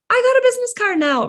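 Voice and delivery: high, loud and fast; excited. Pretend. Newcomer proudly showing a first business card, excited. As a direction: gush like you finally made it.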